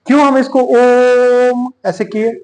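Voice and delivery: ओम stretched long in a slow line; drawn-out om. Shown as a demonstration. Teacher showing students the held ओम. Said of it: "तान मारे क्यों?".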